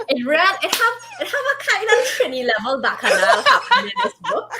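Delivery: laughing, Trinidadian dialect; playful Trini creole; Trini accent. Pretend.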